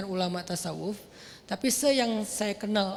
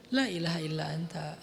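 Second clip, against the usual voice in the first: humble prayer; 6 semitones lower, 6 dB quieter than usual. Shown as a demonstration. Real Yunus words, humble.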